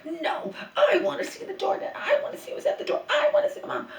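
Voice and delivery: high, squealing mock voice of an upset elderly mother, half laughing; laughing mock whine of a confused mother; imitating screaming mother. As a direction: squeal it like a cranky old mom wanting the door. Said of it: "starts screaming and screaming".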